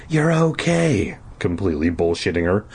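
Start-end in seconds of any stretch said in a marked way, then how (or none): none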